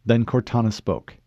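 ordinary delivery

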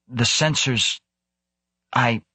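halting, trailing attempt to report; shaking voice. A narrator's reading. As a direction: struggle to get each word out. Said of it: "his voice shaking".

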